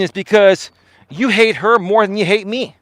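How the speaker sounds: ordinary delivery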